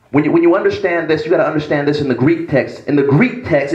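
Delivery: ordinary talk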